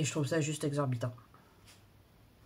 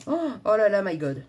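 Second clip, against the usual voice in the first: shocked oh là là; 3 semitones higher, 9 dB louder than usual. Real, not acted. To her viewers, on seeing the tiny print: loud dismayed gasp. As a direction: recoil at the page.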